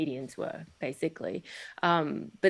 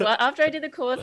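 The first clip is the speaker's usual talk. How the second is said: laughing; 9 semitones higher, 3 dB louder, faster than usual. Real, not acted. Laughing while answering the request.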